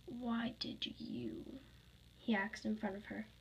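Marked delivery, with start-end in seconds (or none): 0.1-1.6 very slow whispered question; soft, whispery interrogation; menacing whisper. Acted. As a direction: draw it out in a hushed threat. Villain questions her menacingly.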